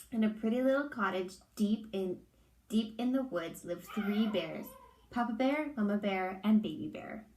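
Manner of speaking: ordinary delivery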